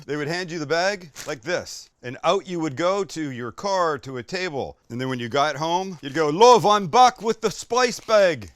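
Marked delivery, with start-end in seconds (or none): 6.3-8.5 loud, higher-pitched, drawn-out mock Irish accent; botched Irish accent. Customer back from the takeaway calling to their love, botched Irish accent. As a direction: call it out in a badly faked Irish accent. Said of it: "Wrong accent, sorry".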